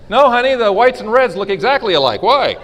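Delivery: high, loud playing-dumb husband voice; mock innocent husband. Husband to wife dodging laundry, mock innocent. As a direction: act innocent, pleading ignorance.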